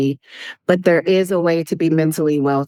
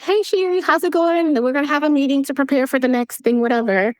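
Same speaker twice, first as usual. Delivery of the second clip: breezy caller; 6 semitones higher than usual. Retold or imitated. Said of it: "you call me one day and you're like".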